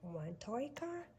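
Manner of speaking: plain reading voice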